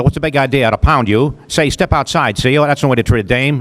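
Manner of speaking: put-on fast old-movie tough-guy voice. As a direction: rattle it off like a thirties film heavy. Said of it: "they kinda talk like this".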